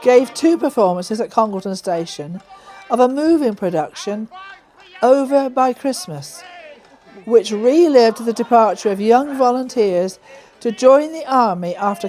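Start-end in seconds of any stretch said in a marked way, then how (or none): none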